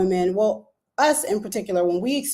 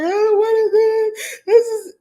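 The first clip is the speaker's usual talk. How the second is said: high, tearful imitated cry; 13 semitones higher, 5 dB louder, slower than usual. Frightened young mother reliving the epidural, tearful and high. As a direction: replay the panic of the needle, voice rising high. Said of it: "'cause I'm crying".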